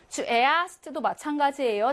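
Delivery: ordinary delivery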